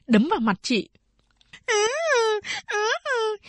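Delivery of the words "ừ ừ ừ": put-on high, whimpering child's wail; a small girl sobbing for her mother; wailing little child. A narrator's reading. Youngest sister wailing in big sister's arms, crying child voice. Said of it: "Cầu Đệ gào khóc".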